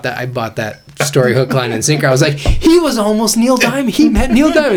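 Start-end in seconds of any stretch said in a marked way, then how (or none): 2.3-4.8 high, loud, wide-eyed retold amazement; mock gullible excitement. Retelling how he bought the story, mock gullible excitement. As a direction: gush it high and loud, sending yourself up.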